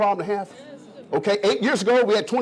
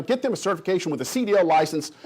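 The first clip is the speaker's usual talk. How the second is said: ordinary delivery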